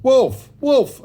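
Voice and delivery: high, loud false alarm; shrieked-up shout; crying out. A narrator's reading. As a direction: cry out to bring the whole town running. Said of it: "when the boy cried".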